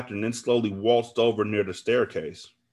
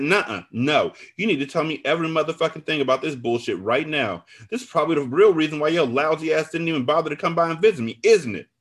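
higher, heated accusing rant; angry demand; 7 semitones higher, 4 dB louder than usual. A narrator's reading. Sick friend demands the truth, angrily accusing. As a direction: build to a loud accusing question.

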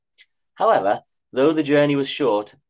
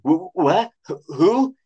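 high frightened stammer; 6 semitones higher, 4 dB louder, slower than usual. A narrator's reading. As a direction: stutter out higher and louder in fear. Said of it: "he stammered in reply".

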